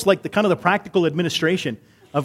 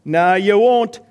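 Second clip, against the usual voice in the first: loud, higher mock Scottish usher; Scottish accent; 4 semitones higher, 6 dB louder, much slower than usual. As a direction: refuse firmly in an attempted Scottish accent. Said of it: "That was my accent attempt".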